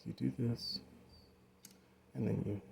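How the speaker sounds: breathy under-the-breath aside; muttering. Real, not acted. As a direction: grumble it to yourself. Muttering when the effect fails.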